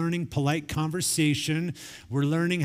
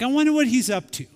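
higher, louder, quicker sly wonder; mock innocent; 9 semitones higher, 5 dB louder, faster than usual. Dad musing to his daughter, sly and quick. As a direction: tease it out like a scheme. Dad's mischievous idea.

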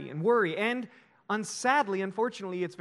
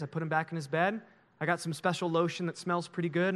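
ordinary talk

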